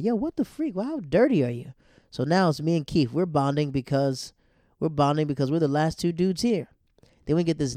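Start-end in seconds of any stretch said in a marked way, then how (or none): 0.0-1.7 high, loud incredulous outburst; shocked at a filthy new roommate, voice up high; disgusted shock. Retold or imitated. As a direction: react like you just saw the mess. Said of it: "And I'm like".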